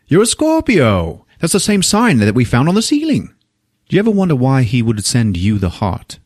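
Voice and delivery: mock Oxford English; British accent. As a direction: play a British detective, voice lifted. Voices Durkin, Oxford educated detective.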